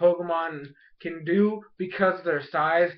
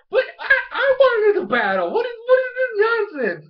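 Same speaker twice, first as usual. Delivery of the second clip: high, loud mock wail of protest; upset, freaking out; 6 dB louder than usual. Fletchling denied his battle, wailing in mock outrage. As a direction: squawk it indignantly, acting out a meltdown. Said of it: "he's all upset and everything".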